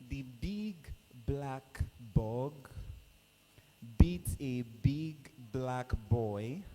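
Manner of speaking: slow, deliberate tongue twister demo. Shown as a demonstration. MC showing contestants the tongue twister slowly. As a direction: spell it out word by word, slowly. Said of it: "Listen to me, please".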